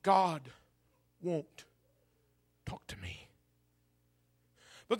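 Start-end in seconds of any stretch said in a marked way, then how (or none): 0.0-3.3 low, halting words fading to a whisper; despondent. Acted. Preacher as Job with faith at its lowest, despondent. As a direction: let it die away, faith nearly gone. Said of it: "his faith in God is at an all-time low".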